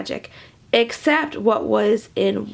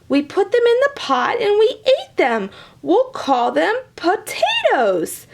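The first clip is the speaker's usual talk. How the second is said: high and proud naming; leprechaun voice; 7 semitones higher than usual. Acted. Leprechaun naming potatoes, high and proud.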